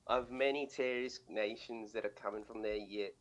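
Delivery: scuffed, drugged-out Aussie accent, cracking up; Australian accent. Shown as a demonstration. Co-host imitating a student's paper for laughs, crazy Australian accent. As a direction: read it in a badly faked Australian accent. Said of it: "drugged out accent".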